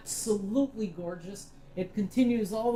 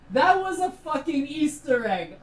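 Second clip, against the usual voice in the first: excited; 8 semitones higher, 9 dB louder than usual. Real, not acted. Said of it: "You fucking jump scared me".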